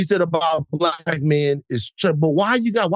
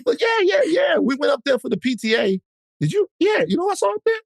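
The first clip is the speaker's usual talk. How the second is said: gossiping Southern lady; 11 semitones higher than usual. Retold or imitated. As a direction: play a nosy mom at the PTA. Acting out PTA gossip.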